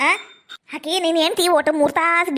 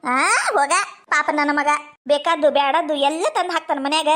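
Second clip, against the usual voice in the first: ordinary delivery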